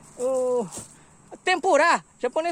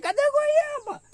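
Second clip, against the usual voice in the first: playful high call; 11 semitones higher, slower than usual. Real, not acted. Joking search for guava.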